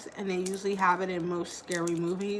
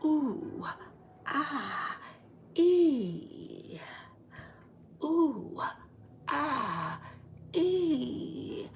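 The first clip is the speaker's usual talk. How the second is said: higher, soft breathy ooh-ah sighs; seductive moaning; 5 semitones higher, 4 dB quieter, much slower than usual. Retold or imitated. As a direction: tease like sultry women in a den. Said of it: "they were just like".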